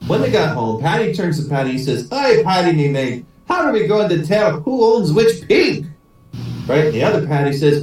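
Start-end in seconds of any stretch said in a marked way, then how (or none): 2.1-5.9 put-on Irish accent. Paddy asks his mate how to tell whose pig, Irish accent. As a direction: joke Irishman's lilt, rising high on the question. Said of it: "try out my Irish accent".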